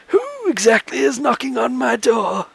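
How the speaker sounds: put-on high, soft old man; quavery old man asking; frail old man voice. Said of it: "a frail voice".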